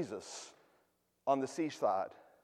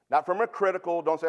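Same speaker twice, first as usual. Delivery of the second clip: ordinary talk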